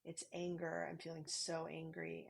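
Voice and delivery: quiet inner naming of anger; naming anger quietly. Shown as a demonstration. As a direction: murmur the feeling to yourself.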